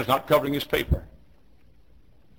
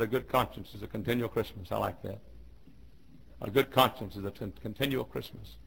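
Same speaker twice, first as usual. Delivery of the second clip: quiet reflection; 2 semitones lower, 10 dB quieter than usual. Real, not acted. Preacher shares Franklin's saying on conscience, quiet. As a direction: let the saying settle softly. Quotes Franklin after shouted climax.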